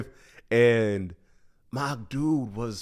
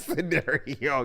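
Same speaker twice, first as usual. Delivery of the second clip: usual speaking voice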